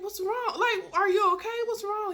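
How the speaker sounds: high-pitched, urgent check on someone; alarmed questioning. Retold or imitated. Acted alarmed questions to date.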